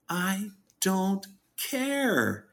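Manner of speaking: high, drawn-out mock exasperation; exasperated retort. Answering people's dissertations on Trump, in mock exasperation. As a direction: stretch each word high, as if fed up.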